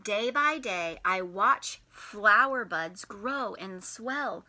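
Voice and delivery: usual speaking voice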